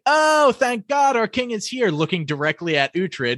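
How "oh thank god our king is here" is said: high-pitched mock relief, swooping up on the greeting; relieved abbot. Abbot greeting the supposed king, mock relieved. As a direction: imitate a thankful abbot in a much higher voice. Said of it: "the abbot's just like".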